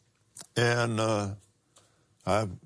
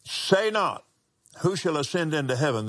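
preaching; 6 semitones higher than usual. Acted. Scripture reading, raised.

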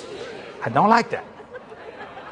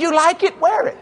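much higher, pleading give-in; husband caving in to his wife, high and exasperated; 10 semitones higher than usual. Retold or imitated. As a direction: throw up your hands and give in. His exasperated reply in quarrel.

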